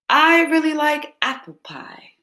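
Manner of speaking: ordinary delivery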